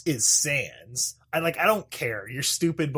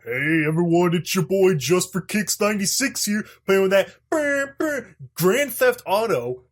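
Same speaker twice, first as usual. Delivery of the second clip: loud, high hyped-up YouTuber intro; 4 semitones higher, 6 dB louder than usual. Retold or imitated. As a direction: hype it like a gaming channel host. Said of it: "I hate people like".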